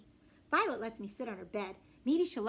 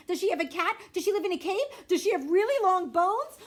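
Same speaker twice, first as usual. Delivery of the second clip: higher, louder rapid-fire questions; eager questions; 7 semitones higher, 7 dB louder than usual. Acted. Little sister quizzing her siblings, bursting with excitement. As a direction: pepper them with excited questions.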